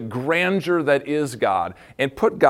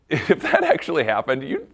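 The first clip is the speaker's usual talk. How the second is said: normal talking voice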